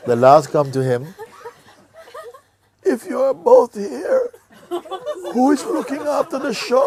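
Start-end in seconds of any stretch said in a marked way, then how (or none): 2.8-6.8 high, loud, broken up by laughter; laughing punchline. Acted. The dying father suddenly worrying about the shop, laughing high. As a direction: squeal it through laughter.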